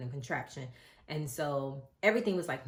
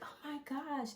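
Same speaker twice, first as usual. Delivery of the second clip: much higher, breathy-soft overwhelmed exclamation; overwhelmed gasp; 11 semitones higher, 6 dB quieter than usual. Retold or imitated. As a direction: let it catch in your throat with emotion. Retold crying at birth.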